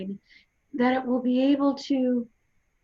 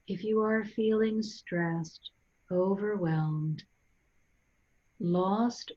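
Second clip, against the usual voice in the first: guided meditation. Real, not acted. Guide leading listeners to breathe and centre, calm guided meditation. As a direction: guide them gently inward, unhurried, phrase by phrase.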